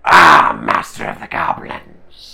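deep, loud croaking goblin cry; a crowd of goblins hailing their master. Acted. GM voicing the goblins.

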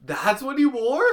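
high, loud and slow disbelief; surprised. Real, not acted. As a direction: ask it in shock. Shocked at the photos.

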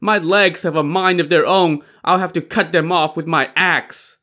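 high-pitched, exasperated complaint; lamenting. A narrator's reading. Li Kui laments his runaway legs, complaining. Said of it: "Li Kui lamented".

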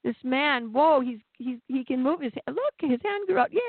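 high, mock-delighted cheering; mock celebration the Pharisees never gave; sarcastic mock cheer. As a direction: gush sarcastically like a thrilled fan. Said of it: "none of the Pharisees are rejoicing".

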